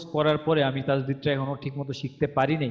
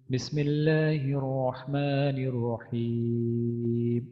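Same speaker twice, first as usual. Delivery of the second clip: slow melodic chanted recitation; Quranic recitation; much slower than usual. Shown as a demonstration. Teacher opening the surah lesson, slow chanted recitation. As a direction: intone it slowly like tilawat.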